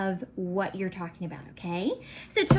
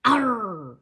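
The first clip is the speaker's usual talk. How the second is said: pirate arr; 4 dB louder than usual. Shown as a demonstration. Teacher showing the class a pirate arr growl. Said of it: "best pirate voice that you've got".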